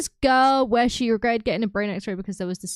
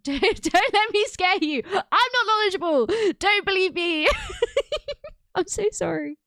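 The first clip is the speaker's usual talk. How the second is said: high laughing backpedal, voice up and louder; laughing apology; 9 semitones higher, 3 dB louder than usual. Real, not acted. To the scared viewer Hugh, laughing apology. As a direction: giggle through it, half sorry.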